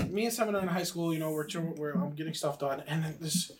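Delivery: usual speaking voice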